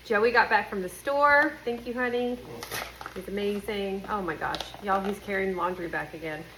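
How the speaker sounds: ordinary delivery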